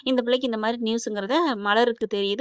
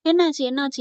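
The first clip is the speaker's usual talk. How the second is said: usual speaking voice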